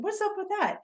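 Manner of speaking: high puzzled question. Retold or imitated. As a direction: ask it surprised at yourself. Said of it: "I was like".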